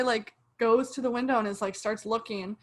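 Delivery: usual speaking voice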